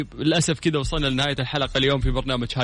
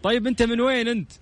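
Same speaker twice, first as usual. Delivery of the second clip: raised voice; 10 semitones higher than usual. Real, not acted. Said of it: "علي صوتك معليش".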